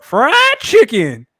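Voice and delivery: high, loud, drawn-out mimicry; mocking her voice. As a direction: stretch it out like she did. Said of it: "It's the way she said it".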